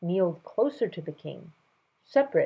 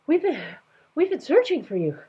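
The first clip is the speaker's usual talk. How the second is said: out of breath; 11 semitones higher, 6 dB louder than usual. Acted. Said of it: "an out-of-breath voice said".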